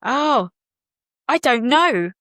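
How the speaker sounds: ordinary talk, nothing marked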